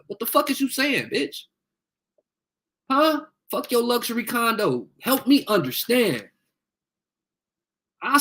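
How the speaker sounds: high, heated furious demand. Real, not acted. Mother yelling at a troll on live, high and furious.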